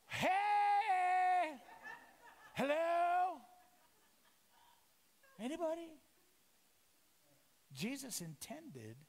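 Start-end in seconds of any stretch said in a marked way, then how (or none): 0.1-5.9 loud high call after someone passing by. Retold or imitated. Disciple in the boat hails Jesus walking past, calling out loudly. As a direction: shout to get his attention.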